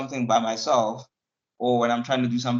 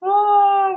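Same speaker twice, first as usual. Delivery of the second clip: mock wail; 7 dB louder than usual. Real, not acted. Crying out loud, high.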